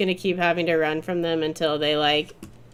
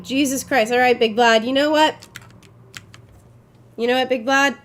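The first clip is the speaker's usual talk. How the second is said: normal talking voice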